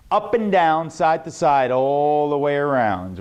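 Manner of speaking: singsong, stretching each phrase; carried-out exercise. Shown as a demonstration. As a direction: roll through it, pitch falling by the end. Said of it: "Write this exercise down".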